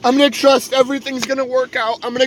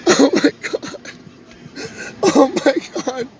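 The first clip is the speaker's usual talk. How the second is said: overcome; 4 semitones higher than usual. Real, not acted. Overwhelmed, feeling uncle's presence.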